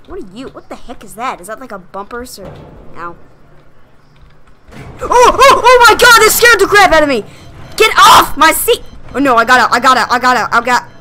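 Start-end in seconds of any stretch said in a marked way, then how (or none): 5.0-7.2 startled scream. Real, not acted. Sudden jump scare.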